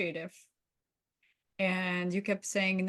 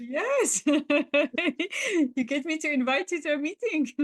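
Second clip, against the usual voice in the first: laughing; 8 semitones higher, 6 dB louder, slower than usual. Real, not acted. Laughing at being talked into it.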